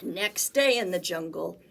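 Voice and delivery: ordinary delivery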